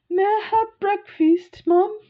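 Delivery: imitating a tiny girl asking very high and prim in a British accent; British toddler voice. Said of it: "almost like she was British".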